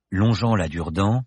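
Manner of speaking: usual speaking voice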